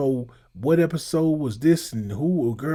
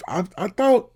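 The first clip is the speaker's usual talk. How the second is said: startled and high, disbelieving; surprised; 7 semitones higher, 8 dB louder than usual. Real, not acted. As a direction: blurt it out in shock. Reacting to the search result.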